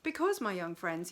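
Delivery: plain reading voice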